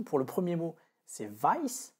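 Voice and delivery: vice said with the English "ai" sound, slowly; slow demo of vice. Shown as a demonstration. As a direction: model vice clearly for learners. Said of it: "Donc je refais la prononciation".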